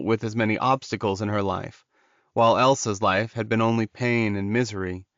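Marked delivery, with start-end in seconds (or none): none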